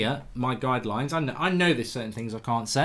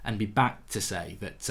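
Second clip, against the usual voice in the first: usual speaking voice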